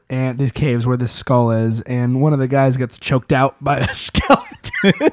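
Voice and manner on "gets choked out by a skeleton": slow, breaking into laughter at the end; real laugh bursting through the last word; trying not to laugh. Real, not acted. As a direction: hold back a laugh and fail. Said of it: "I tried to say it without laughing".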